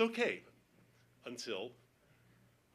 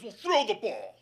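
raised, louder irritated command; pissed-off order; 6 semitones higher, 5 dB louder, slower than usual. Retold or imitated. Dog owner ordering the neighbor to throw, irritated. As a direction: order it impatiently, voice up. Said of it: "He was pissed".

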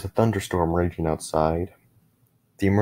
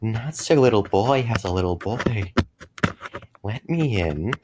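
higher, swooping raspy creature voice; creepy rasp, pitch sliding up and down; raspy monster whisper; 4 semitones higher than usual. A narrator's reading. Monster at the window taunts the father, raspy creepy whisper. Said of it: "I heard a raspy whisper say".

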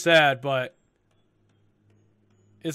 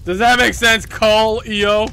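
put-on loud, raised drunken challenge; drunken outburst; 4 semitones higher, 8 dB louder than usual. Drunk girl confronts a listener, loud outburst. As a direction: push it out loud.

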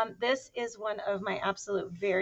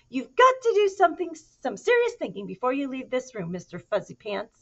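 mother lecturing; 6 semitones higher, 7 dB louder than usual. Acted. Mother lecturing her kitten.

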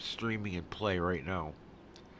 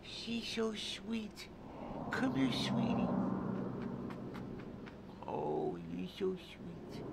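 doting falsetto pet voice; cooing to a cat; 10 semitones higher, 4 dB quieter, slower than usual. Real, not acted. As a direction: coo tenderly at a pet, high and hushed.

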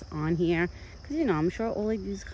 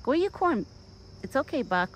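soothing pet talk; 9 semitones higher than usual. Real, not acted. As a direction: coax the frightened deer back to his food. Urging deer to eat.

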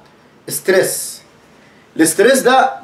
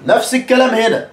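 usual speaking voice

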